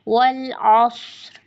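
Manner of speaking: अस्र with a thick, full saad; heavy saad. Shown as a demonstration. Teacher modelling the full saad, slow. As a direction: raise the tongue root and say अस्र heavy. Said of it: "जुबान की जड़ को तालु की जानब उठाकर पुर करें".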